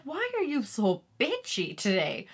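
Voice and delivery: mock-exasperated question, voiced as the partner. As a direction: act out a fed-up partner asking. Retells partner's complaint about her mood.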